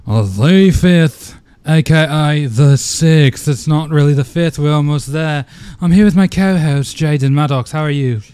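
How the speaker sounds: loud, slow, hammed-up London host; terrible put-on London accent, loud; bad London accent. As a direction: belt it out in a fake British accent. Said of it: "those are terrible accents".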